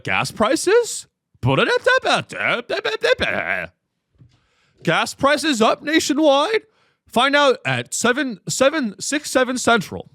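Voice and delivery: high, slow teaser read with rising questions; news reporter accent. Retold or imitated. Mocking news reporters, high teaser anchor voice. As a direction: parody a cable news promo. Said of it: "talks in the news reporter accent".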